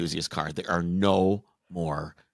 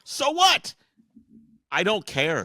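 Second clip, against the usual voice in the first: exasperated; 8 semitones higher, 5 dB louder than usual. Real, not acted. Exasperated outburst over CVT.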